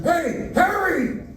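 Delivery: high, slow drawn-out mock shout; mock yell. Hecklers in his youth calling out in a mock yell. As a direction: call out across the street in jest. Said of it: "yelling at me through my life going".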